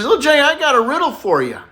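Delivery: a high hammed-up teacher setting a riddle. Teacher voiced in joke.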